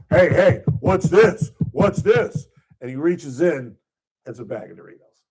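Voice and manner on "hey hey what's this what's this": high, loud mock police questioning; imitated cop. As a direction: act out an officer demanding answers. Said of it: "the cop is all like".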